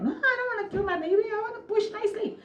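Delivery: high, quick pleading voice; high panicked plea about the baby. Retold or imitated. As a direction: beg in a high little voice. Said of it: "I was like".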